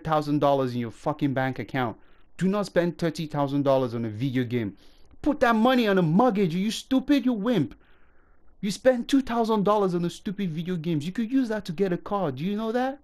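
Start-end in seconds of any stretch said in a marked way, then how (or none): 5.2-13.0 ranting at fans who waste money, angry; pissed-off rant. Real, not acted. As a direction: tear into the viewers for wasting money. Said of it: "I am pissed, and you can hear it in my voice".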